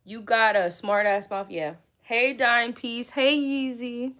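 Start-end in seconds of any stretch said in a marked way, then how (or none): 2.0-4.1 playful greeting. Acted. Sing-song greeting.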